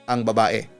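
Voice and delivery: ordinary delivery, nothing marked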